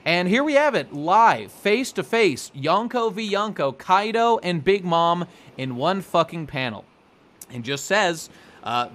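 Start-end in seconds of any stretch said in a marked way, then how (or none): 0.0-6.8 louder, higher fight-night announcer call; mock sports-announcer hype for the big clash. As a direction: sell the showdown like a ring announcer.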